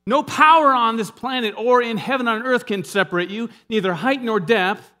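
loud and high, ringing out; preaching at full force. Real, not acted. Preacher assuring Christians nothing can separate them, loud proclamation. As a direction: proclaim it to the back row. Preacher's climax on God's promise.

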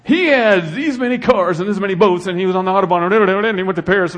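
preacher mocking a materialist obituary, lightly voiced. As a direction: scoff at the junk he owned. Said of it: "Is that what you read?".

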